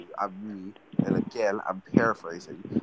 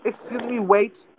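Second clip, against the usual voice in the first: high-pitched pretend call for service; hammed-up "waiter!" call, pitched way up; mock customer calling out; 10 semitones higher, 6 dB louder, slower than usual.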